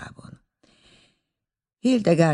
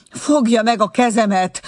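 worried, higher and louder offer of help; anxiously; 9 semitones higher, 7 dB louder than usual. A narrator's reading. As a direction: urge her to take his hand, louder. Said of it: "mondta aggodalmaskodva".

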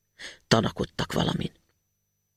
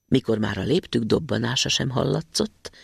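usual speaking voice

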